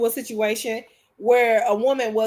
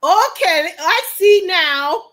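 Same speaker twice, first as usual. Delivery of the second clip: higher, louder, slow knowing tone; mock suspicious husband; 6 semitones higher, 7 dB louder, much slower than usual. Wife mimicking husband's suspicious slow reply, raised. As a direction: drag it out like you caught her. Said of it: "He gets like that".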